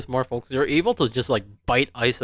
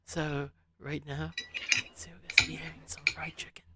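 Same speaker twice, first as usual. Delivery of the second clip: ASMR whisper; 9 dB quieter than usual. Acted. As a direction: breathe the words like an ASMR video. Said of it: "if it's A S M R".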